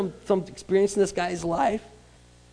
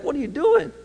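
incredulous guy; 10 semitones higher, 4 dB louder than usual. Retold or imitated. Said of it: "if you're this guy, like".

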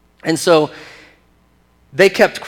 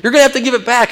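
high, loud mock-outraged shouting; exasperated, raised-voice impression of outrage; 9 semitones higher, 6 dB louder than usual. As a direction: cry out in hammed-up indignation. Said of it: "he's all outraged".

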